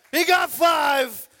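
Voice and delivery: high, loud and slow, stretching every word; slow triumphant shout; drawn-out cheer. Real, not acted. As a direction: announce it like a game-show host. Repeat, drawn out.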